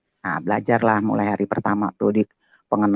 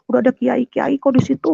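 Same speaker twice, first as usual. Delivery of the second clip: high-pitched retold answer; urgent neighbour talk; 12 semitones higher than usual. Neighbour says clerics are there, urgent retold talk. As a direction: imitate a worried neighbour brushing off help.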